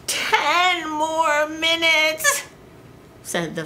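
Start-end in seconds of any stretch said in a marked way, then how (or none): 0.1-2.4 sleepy farmer. Acted. Sleepy farmer begs for time.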